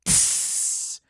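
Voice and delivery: soft hiss of truck air brakes; breathy unvoiced brake hiss. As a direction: imitate the brakes locking with a hiss. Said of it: "and you hear".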